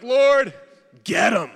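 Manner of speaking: hammed-up prayer yelled high and loud; mock vengeful prayer. Pastor mimicking a harsh prayer, hammed-up shout. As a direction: holler it like a half-joking curse.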